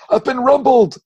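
high, loud comic outburst; startled cry. Acted. Ghost spotted in the corner, startled shout. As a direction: blurt it out like someone caught red-handed.

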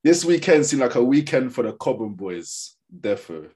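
normal talking voice

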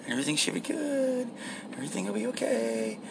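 high, very soft sing-out; soft self-reassurance. Pretend. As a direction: reassure yourself in a small high voice. Nervously reassuring himself before work.

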